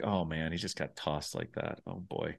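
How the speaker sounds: soft, low retold dismay; sympathetic aside. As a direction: drop right down, feeling bad for him. Retelling own sympathy for Johnny.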